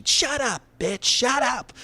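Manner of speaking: shrill-free, high mock yell at the guy; mock shriek.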